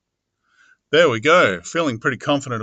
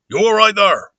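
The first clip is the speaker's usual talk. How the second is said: raised, loud rustic call; Mister Hall; 7 semitones higher, 7 dB louder than usual. Acted. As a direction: call out higher in a countryman's voice. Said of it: "Okay, Mister Hall".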